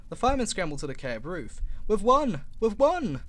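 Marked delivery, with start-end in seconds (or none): 1.9-3.2 loud, higher triumphant shout. Acted. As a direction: yell the win from the roof. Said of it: "he shouted".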